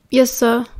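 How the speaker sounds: a hint of a croak; mildly croaked answer; croaky. A narrator's reading. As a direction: croak out a short, weak reply. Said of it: "Remus croaked".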